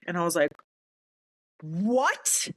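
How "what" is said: higher, rising stunned question; stunned disbelief. Retold or imitated. Sergeant learning the counsel is the new JAG, stunned. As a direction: draw out a rising question in shock. First of escalating shocked reactions.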